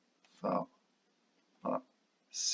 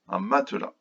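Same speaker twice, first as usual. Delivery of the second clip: ordinary talk, nothing marked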